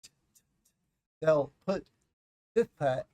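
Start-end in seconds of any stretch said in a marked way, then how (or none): 0.0-0.4 soft clicking t sounds; tongue-tip T. Shown as a demonstration. Teacher demonstrating tongue placement for T. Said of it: "putting the tip of their tongue".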